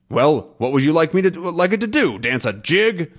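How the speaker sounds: a hint of a gruff captain, fast and annoyed; Haddock voice. Haddock mocking Calculus, lightly voiced and quick. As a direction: snap back the sarcastic question. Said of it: "he says".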